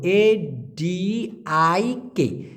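A D I K letter by letter, very slowly; spelled out. Shown as a demonstration. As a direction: spell it out deliberately. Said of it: "খেয়াল করে দেখুন".

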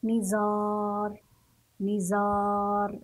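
نزار with a long, held aa; slow teacher's model of نزار, aa sustained; stretched alif. Shown as a demonstration. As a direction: draw out the middle of نزار, "nizaaar". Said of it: "سنمد الحرف عند القراءة إلى فوق".

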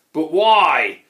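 loud, drawn-out troll protest; dismayed troll. A narrator's reading. Grunt asks why his friend is leaving, loud dismay. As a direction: wail the question out loud.

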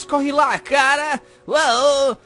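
loud, high, hammed-up cheer; big exclaiming sales shout; hyped-up plug. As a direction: push the plug like an excited promo. Hammy reading of letter's plug.

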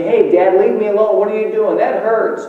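put-on high, loud child's protest; yelling out. Boy protesting to his dad as he squeezes his hand, high yell. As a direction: yell it out in a pleading high pitch. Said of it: "the type to yell out".

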